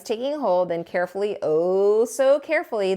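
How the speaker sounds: ordinary delivery, nothing marked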